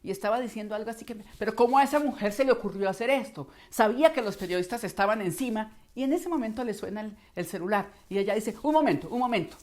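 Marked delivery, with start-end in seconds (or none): 1.4-5.8 loud, high, fast outburst; an irate boss venting; angry. Acted. 8.6-9.6 curt irritation. Acted. Irate boss interrupted by a phone.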